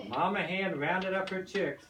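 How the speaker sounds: ordinary delivery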